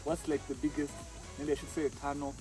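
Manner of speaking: ordinary delivery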